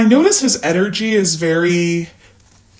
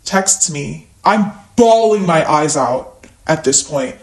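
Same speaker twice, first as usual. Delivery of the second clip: ordinary delivery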